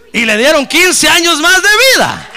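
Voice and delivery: high, loud shouting of praise; ringing gloria a Dios at the top of the voice; full-force preaching. Real, not acted. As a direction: lift the church with a triumphant cry.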